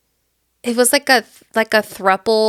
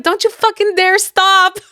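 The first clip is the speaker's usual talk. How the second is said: high-pitched, hammed-up order; mock urgent command; 9 semitones higher, 4 dB louder than usual. [name] on the phone to Carl, mock urgent. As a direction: command it high, on the edge of laughter.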